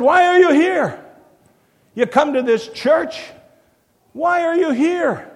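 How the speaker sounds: loud, high, slow shouted challenge; an intruder shouting down the nave. Retold or imitated. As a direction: yell it at the congregation from the aisle. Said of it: "he's shouting in German".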